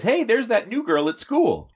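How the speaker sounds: put-on high, cheery fifties teen; cheesy teen ad voice. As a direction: read it like a corny old ad. Varsity Vic ad voice.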